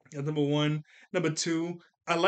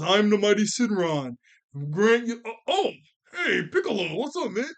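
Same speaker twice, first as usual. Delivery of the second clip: mock mighty dragon; 5 semitones higher, 5 dB louder than usual. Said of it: "Shenron goes".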